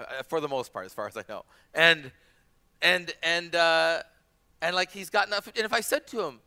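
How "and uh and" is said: ordinary delivery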